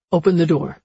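calls it out loudly through a door; loud, firm call; shouting. A narrator's reading. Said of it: "he shouts".

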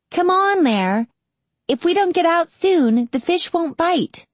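high-pitched impatient little sister; impatient urging. Acted. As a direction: hurry big brother along in a high girl's voice. Girl urges brother to go fishing.

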